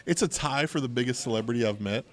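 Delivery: usual speaking voice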